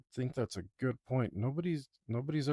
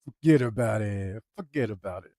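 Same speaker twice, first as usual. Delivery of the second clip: New York accent; 7 dB louder than usual. Shown as a demonstration. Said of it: "grow into my character's accent".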